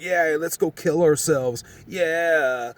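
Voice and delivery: a hint of a careless slacker drawl; imitating Dens. Mimicking friend Dens suggesting they lie in the street, lightly put-on voice. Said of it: "He, like, kinda really talked like that".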